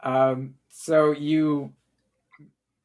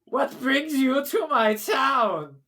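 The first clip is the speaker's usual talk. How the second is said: booming king voice; 10 semitones higher, slower than usual. Acted. A mad king proclaims himself to the visitors, high and crying out. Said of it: "you hear a voice go".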